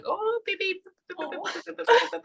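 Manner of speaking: very high, squeaky beeps; imitating a shrill beep-beep voice. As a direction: mimic a piercing high-pitched beeping. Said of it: "like that".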